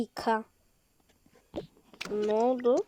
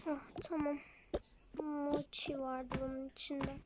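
usual speaking voice

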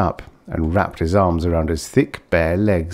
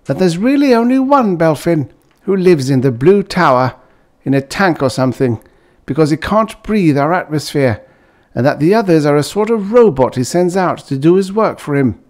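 excited; 9 semitones higher, 5 dB louder than usual. Acted. Uncle spreads the rumour, excited.

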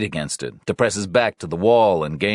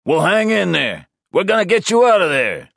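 loud, high calling reassurance; shouted reassurance; 12 semitones higher, 5 dB louder than usual. Acted. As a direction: call encouragement through the door. Officer calling through door.

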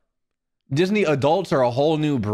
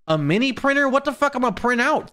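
higher-pitched, exasperated griping; mock outrage; 8 semitones higher than usual. Real, not acted. Streamer griping about mini Christmas gifts, comically mad. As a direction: gripe in incredulous high-pitched questions. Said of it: "I'm kinda mad right now".

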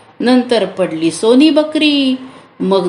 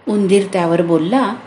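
usual speaking voice

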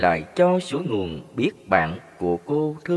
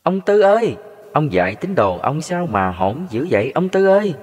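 người chồng hiền kêu than, giọng cao hơn, hơi to; cất giọng than với Thầy về vợ; than thở; 5 semitones higher, 4 dB louder than usual. A narrator's reading. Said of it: "Tôi than và nói gì nè". (giọng than vãn, trách nhẹ)

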